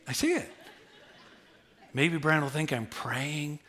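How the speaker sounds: ordinary talk, nothing marked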